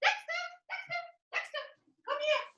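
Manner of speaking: very high sing-song dog call. Real, not acted. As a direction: coo the dog's name up high. Calling the dog over.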